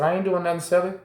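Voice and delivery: a lightly voiced, high innocent cellmate; cellmate's denial. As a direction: play the odd cellmate brushing it off. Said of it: "He said".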